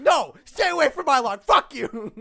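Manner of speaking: high, loud imitated yell; mock furious yell. GM imitating Hawk yelling at the orc. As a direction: yell it like the angry prisoner. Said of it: "Hawk going".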